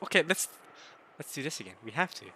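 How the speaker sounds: ordinary talk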